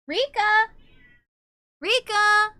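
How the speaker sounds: loud high call across the house; calling a cat from the next room. Real, not acted. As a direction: shout the cat's name to fetch her.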